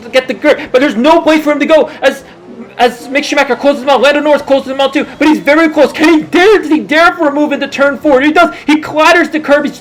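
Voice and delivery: fast, rising live race commentary; motorsport commentator. Acted. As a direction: call the race play-by-play, building up.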